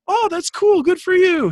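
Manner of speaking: high-pitched mock congratulation; impressed cab driver. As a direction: imitate a driver who is delighted for you. Retells cab driver's impressed reaction.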